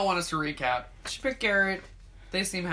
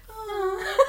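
mock sweet aww; 11 semitones higher than usual. Imitating Becca's aww.

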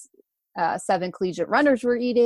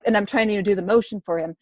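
normal talking voice

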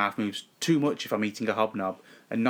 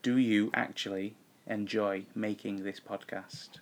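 slow, softer, every word spelled out; slower; 6 dB quieter than usual. Shown as a demonstration. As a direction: stretch the question out slowly so it can be lip-read. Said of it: "S-, slower".

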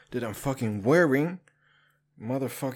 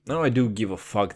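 normal talking voice

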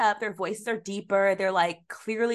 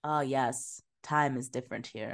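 low, soft, deadpan acceptance; lowered voice, mock-unbothered; mock calm realization; 6 semitones lower, 6 dB quieter than usual. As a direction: placidly imitate someone accepting the absurd. Mocking characters' calm late realization.

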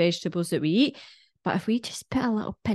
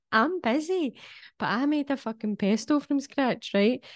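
pitched way up, fired-up insistence; worked-up rant; 6 semitones higher than usual. Real, not acted. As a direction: push it like you're losing patience. Ranting about cooking from scratch.

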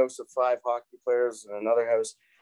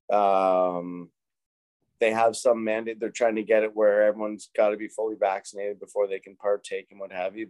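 ordinary talk

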